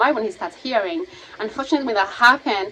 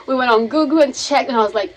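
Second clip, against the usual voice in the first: ordinary talk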